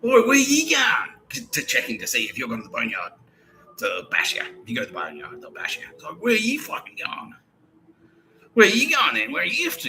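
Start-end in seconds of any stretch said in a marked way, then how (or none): 0.0-1.1 high, loud, slow "Oi" of a suspicious guard; guard calling out. Retold or imitated. 6.2-9.9 high, pushy questioning, much higher and louder; suspicious guard. Retold or imitated.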